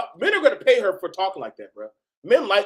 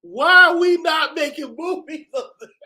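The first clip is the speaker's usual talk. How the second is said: loud, high, laughing demand; hyped shout; 8 semitones higher, 6 dB louder than usual. Real, not acted. Reacting to the video, a laughing excited shout. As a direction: shout it in gleeful disbelief.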